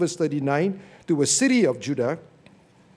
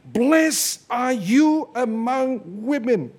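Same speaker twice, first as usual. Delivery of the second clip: higher and slow, ringing out the blessing; loud proclamation; 7 semitones higher, 3 dB louder, slower than usual. A narrator's reading. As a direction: proclaim it like Elizabeth crying out. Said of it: "she spoke out with a loud voice".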